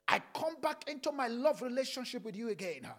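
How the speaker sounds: usual speaking voice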